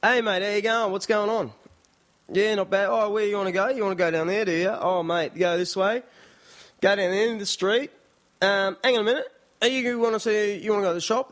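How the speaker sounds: high, run-together bogan Aussie patter. Retold or imitated. Locals greet a Japanese newcomer, high bogan Aussie accent. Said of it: "confused by our bogan accent".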